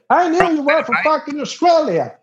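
high-pitched triumphant imitation; high, strained mock outburst; worked-up local. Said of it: "he becomes to be upset".

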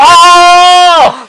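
long, high, loud "oh"; high-pitched horrified wail; appalled outcry. Real, not acted. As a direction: cry out in loud disbelief at a gross story. Appalled reaction to gross story.

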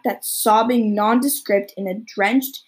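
ordinary delivery, nothing marked

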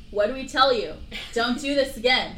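hammed-up exasperated scolding; scolding dwarves. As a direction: tell her off like exasperated parents.

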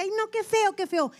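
high, prissy "ay no, qué feo"; mock-dainty disgust, high; squeamish disgust. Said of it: "Y decía".

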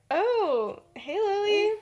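high, drawn-out uneasy hello; awkward polite greeting. Acted. Venus caught off guard by gushing Lily, awkwardly polite.

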